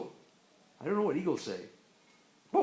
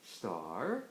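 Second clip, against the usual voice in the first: "Star" low and soft, rising; questioning rise; 14 semitones lower, 7 dB quieter than usual. Pretend. Parent prompting a child with "Star", low rising tune. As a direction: lift "Star" up from a low start. Word game, alternating star and turtle.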